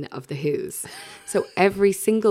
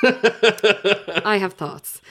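slow laughing; 4 semitones higher, 5 dB louder, much slower than usual. Real, not acted. Laughing lead into story.

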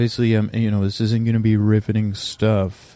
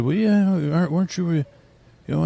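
a higher, needling inner voice; another part of me; 8 semitones higher than usual. Retold or imitated. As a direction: play the nagging part of your brain, pitched up. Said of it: "another part of me said".

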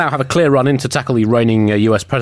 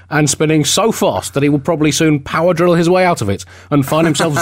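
ordinary delivery

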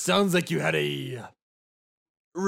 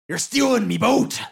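indignant accusation; 6 semitones higher, 5 dB louder than usual. Acted. Pirate accuses prisoner in character.

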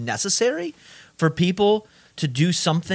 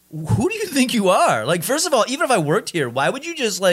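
higher, mock-outraged comeback; mock indignant; 7 semitones higher than usual. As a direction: play up the offended retort. Retold indignant reply to rude customer.

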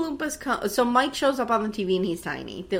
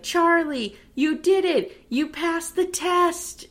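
higher, slow beaming praise; sudden joy; 8 semitones higher, slower than usual. Retold or imitated. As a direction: turn on a dime into delight. Wonka suddenly joyful.

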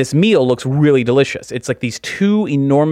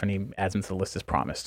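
normal talking voice

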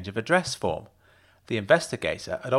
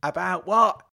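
higher, louder husband voice; shouted back; 8 semitones higher, 4 dB louder than usual. A narrator's reading. Husband shouts a question back to his wife. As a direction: shout it back from the other room. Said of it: "he shouted back".

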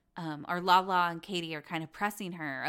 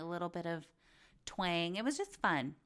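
usual speaking voice

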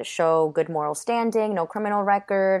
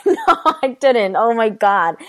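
louder, laughing no; laughing denial; 6 semitones higher, 8 dB louder than usual. Real, not acted. Laughing loudly when asked if she ever slept. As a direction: laugh it off, loud and high. Laughs answering did you sleep.

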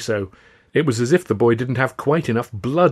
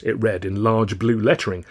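plain reading voice

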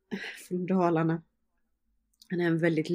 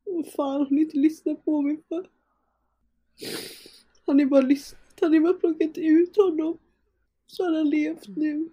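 much higher, loud anguished yelling; furious scream; 10 semitones higher, 4 dB louder than usual. Retold or imitated. Grieving mother screaming at the staff for not listening. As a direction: scream the accusation in raw grief. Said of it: "jag skriker".

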